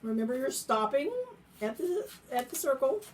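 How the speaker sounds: talking while chewing gum. Real, not acted. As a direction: speak around gum in the mouth. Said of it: "Okay, I have gum in my mouth".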